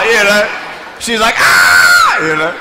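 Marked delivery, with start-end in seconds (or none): 1.4-2.2 loud mock-terrified scream; short, piercing comic shriek; imitated girl's scream. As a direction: scream like a frightened child.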